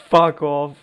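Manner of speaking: usual speaking voice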